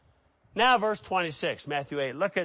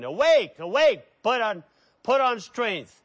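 raised, loud call to wake; crying out; 5 semitones higher, 5 dB louder than usual. Acted. Prophet calling on God to act, crying out. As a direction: punch each plea high and loud. Acting scriptural cries to God.

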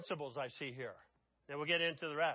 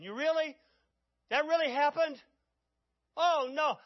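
shocked disbelief; 8 semitones higher, 6 dB louder than usual. Acted. Preacher voicing David's shocked disbelief.

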